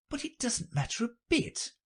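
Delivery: put-on very high, soft girlish voice; high, small private voice; Alice thinking to herself. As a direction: think aloud softly as little Alice. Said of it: "she thought to herself".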